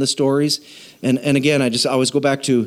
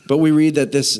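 ordinary delivery

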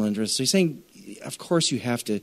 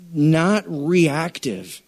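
very slow not reactive; savoring the phrase; drawn out; 3 semitones higher, much slower than usual. Acted. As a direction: stretch each word for weight. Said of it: "very slow emphasis".

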